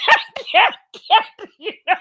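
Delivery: very high, squeaky burst through laughter; helpless real laughter; laughing. Real, not acted. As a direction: crack up mid-word. Cracks up at Misty's comment.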